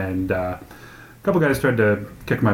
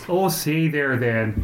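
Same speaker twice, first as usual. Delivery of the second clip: slow, higher mock Minnesotan; Minnesota accent; 4 semitones higher, much slower than usual. Friends mocking their home state's talk, drawn-out Minnesota accent. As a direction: stretch it out in a comic Minnesota accent. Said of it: "we make fun of Minnesota accent".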